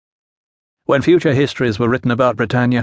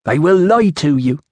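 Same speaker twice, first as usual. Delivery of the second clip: put-on higher, firm Iceni-accented warning; foreign Iceni accent; 4 semitones higher, 3 dB louder than usual. A narrator's reading. Woman warns the official about the council, Iceni accent. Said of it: "trouble following your accent".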